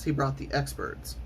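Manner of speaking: ordinary delivery, nothing marked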